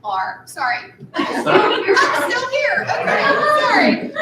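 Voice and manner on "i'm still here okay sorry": teary laugh, high and loud; laughing through tears, voice pitched up; after crying. Real, not acted. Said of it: "Little teary".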